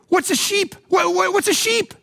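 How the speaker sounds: high silly voice asking; mock sheep bleat. Preacher mocking sheep, high voice.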